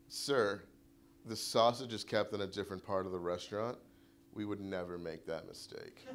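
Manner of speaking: lightly voiced polite clerk brushing him off; mock prim clerk. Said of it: "she said to me".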